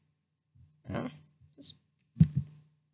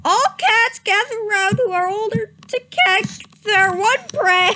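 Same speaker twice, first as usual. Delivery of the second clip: cracking up; 7 semitones higher, 4 dB louder than usual. Real, not acted.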